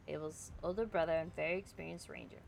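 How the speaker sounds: ordinary delivery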